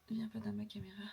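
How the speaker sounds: hushed unvoiced whisper. Real, not acted. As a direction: whisper a shoo-away. Shoos child, whispering.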